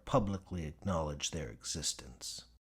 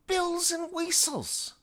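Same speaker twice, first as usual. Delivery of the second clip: put-on very high, louder, slow "whistles" in her accent; exasperated Gadsby; 5 dB louder than usual. Gadsby insisting to Mitchell, very high and slow. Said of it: "Gadsby insists".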